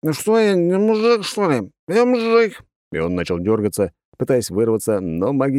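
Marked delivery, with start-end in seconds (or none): 0.0-2.6 put-on drunk. A narrator's reading. Drunk lad boasting, struggling.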